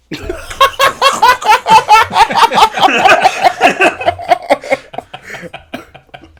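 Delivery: high, loud burst of laughter; real laughter. Real, not acted. Game master at the table, real laughter.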